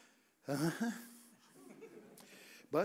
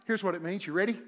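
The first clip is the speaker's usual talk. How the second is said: ordinary delivery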